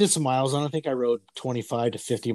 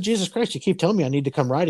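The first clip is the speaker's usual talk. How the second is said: exasperated; 6 semitones higher than usual. Retold or imitated. Arguing with dad about the bike.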